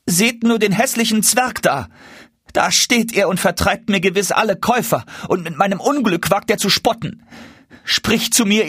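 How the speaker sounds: high-pitched, scolding complaint; indignant calling out. A narrator's reading. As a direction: rouse the neighbours against him in a high voice. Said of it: "rief Hanne ihrer Nachbarin zu". (empört, mit hoher Stimme gerufen)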